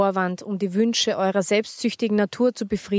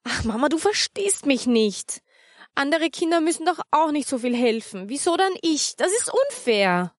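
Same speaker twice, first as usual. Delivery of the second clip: put-on higher, pleading girl's voice; despairing child; 6 semitones higher than usual. Anita pleads with her mother, higher and despairing. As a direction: plead like a child who feels wronged. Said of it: "Anita machte ein verzweifeltes Gesicht".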